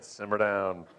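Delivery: low ghostly calming voice; Sir John A. Macdonald. Acted. Ghost calming an angry Canadian, low voice. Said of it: "the ghost of Sir John A. Macdonald came down and said".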